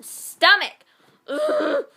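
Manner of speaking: loud high "stomach" then groaning "Ugh"; disgusted yell. Real, not acted. Reading a cringe line aloud, disgusted yell. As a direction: spit it out in disgust. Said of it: "loud disgusted outburst".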